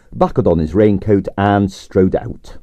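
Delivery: ordinary delivery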